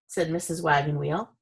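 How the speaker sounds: ordinary delivery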